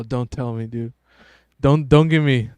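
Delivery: usual speaking voice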